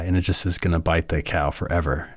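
ordinary delivery

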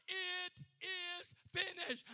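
slow, high, loud victory shout; drawn-out proclamation of Jesus at full force; triumphant cry. Acted. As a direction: cry it out word by word. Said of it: "But he cried".

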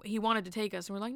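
normal talking voice

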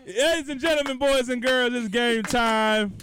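loud, high, slow host announcement; booming kids show opener. Acted. Announcing game time.

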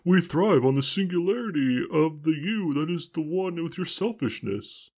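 high laughing guru voice; Barney the dinosaur. Pretend. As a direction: preach it high and dreamy. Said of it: "Barney the dinosaur".

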